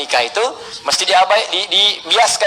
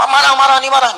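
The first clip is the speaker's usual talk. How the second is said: higher, slightly louder burst of mock anger; 7 semitones higher, 4 dB louder than usual. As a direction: act out getting worked up. Mimes someone getting angry. (pura-pura marah, nada meninggi)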